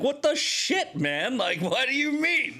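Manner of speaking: high-pitched retold outrage; acted-out exasperation at being refused; indignant protest. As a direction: protest in disbelief, voice leaping up. Retold protest at denied claim.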